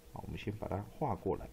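normal talking voice

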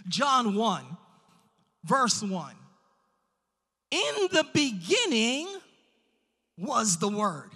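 forceful pulpit encouragement; emphatic preaching. Real, not acted. Preacher encouraging the congregation about a limitless God, emphatic preaching. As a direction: preach it with drawn-out key words and big pauses. Declaims scripture.

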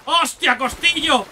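sudden loud cry, pitched high; a real jolt of surprise, shouting his name; shocked yell at the companion; startled shout. Real, not acted. Companion suddenly hit in game.

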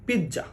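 pizza split as pit-ja; a clear stop on the t in pizza, then ja; pizza pronounced correctly. Shown as a demonstration. Teacher giving learners the right sound, pizza as pit-ja. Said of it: "উচ্চারণ হবে".

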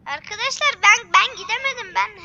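loud, high frustrated cry; frustrated yell. Real, not acted. Fails to escape in game.

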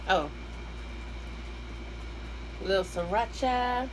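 ordinary talk

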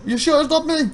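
a high, needy clerk; pushy shop assistant. Retold or imitated. Assistant insisting to the customer, high and needy. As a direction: plead to be the one who helps.

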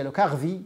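لو كاغفي with a French throat r and a v; French pronunciation. Shown as a demonstration. Lecturer giving the herb's French name, French pronunciation. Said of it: "وبالفرنسية".

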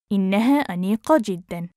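quick, flowing and joined up; fast model sentence; natural speed. Shown as a demonstration. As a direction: run the phrase together fluently. Teacher repeats phrase at speed.

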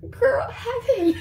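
high, loud, giggly dismissal; giggling mockery. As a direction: hand him over through mocking giggles. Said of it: "giggling, as if it's like".

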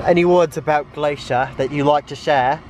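playful mock-British host accent; put-on British accent. Host asks friend about Glacier, mock British accent. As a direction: interview your friend in a fake British accent. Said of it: "not the right accent for this land".

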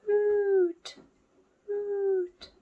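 high hooting owl call. Acted. Teacher showing children the owl noise, high hooting. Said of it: "They go".

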